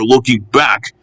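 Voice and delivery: usual speaking voice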